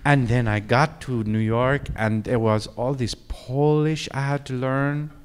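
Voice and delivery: a hint of Marion's complaint, slowed; quoting Marion. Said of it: "and she said".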